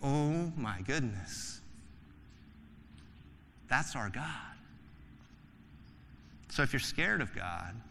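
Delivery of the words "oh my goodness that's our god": awed hush. Acted. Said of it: "sitting on that boat going".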